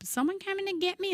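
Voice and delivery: put-on high, plaintive kids asking for a ride; whiny kid text. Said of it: "the phone will start blowing up".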